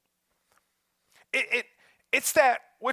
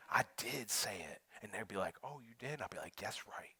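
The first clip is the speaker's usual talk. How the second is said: hushed retold argument; 10 semitones lower, 14 dB quieter than usual. Imagined shower argument.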